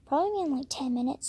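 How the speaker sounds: ordinary talk, nothing marked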